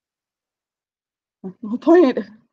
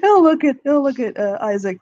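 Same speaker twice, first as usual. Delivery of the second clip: normal talking voice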